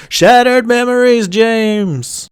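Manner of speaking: high, loud, drawn-out mock wail; mock eerie call. Narrator repeats the line they don't say, high mock wail. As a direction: call it out high and hold the name.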